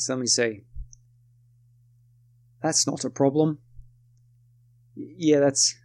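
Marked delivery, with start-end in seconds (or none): 2.6-3.6 put-on a light posh British accent. Narrator imitating Beatrice at the bookshop, light British accent. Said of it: "she has a British accent".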